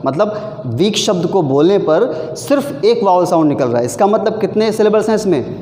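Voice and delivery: usual speaking voice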